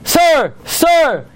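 high, loud mock yell of Sir; a driver yelling at a rider who didn't pay; driver screaming. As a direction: call out sharply like an angry trolley driver. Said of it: "the driver screaming".

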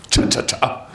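a trail of hissed shushes; repeated shush. Retold or imitated. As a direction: hush the room in a whisper. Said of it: "Ein Scha".

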